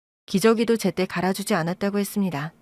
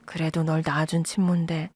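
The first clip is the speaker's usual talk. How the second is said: soft and gentle; 5 semitones lower, 4 dB quieter than usual. A narrator's reading. Mother gently asks daughter.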